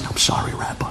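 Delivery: hushed, barely voiced apology; a sheepish boy's whisper to the rabbi. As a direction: breathe it out under your breath. Boy answers the rabbi timidly.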